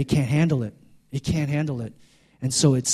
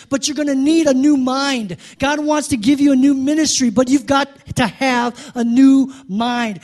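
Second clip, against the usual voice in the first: preaching at full force, higher and louder; climactic preaching; 8 semitones higher, 6 dB louder than usual. Real, not acted. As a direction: build it to a shouting climax. Climax of the sermon.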